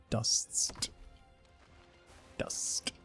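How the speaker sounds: ordinary talk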